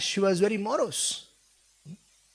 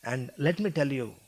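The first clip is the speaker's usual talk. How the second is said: gentle consoling sage; 8 semitones lower, 6 dB quieter than usual. Retold or imitated. Sage consoling his pleading wife.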